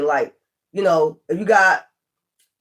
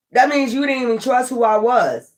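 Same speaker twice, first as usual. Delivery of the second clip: ordinary delivery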